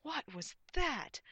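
indignant, high, loud demand; slow, emphatic outraged question. A narrator's reading. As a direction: demand an answer, drawn out. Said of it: "demanded Morgana".

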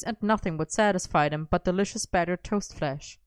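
plain reading voice

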